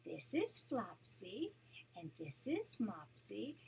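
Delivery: gentle read-aloud storytelling voice; storybook narration for a grandchild; story narration. A narrator's reading. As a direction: read it like a bedtime picture book. Grandma reads picture book aloud.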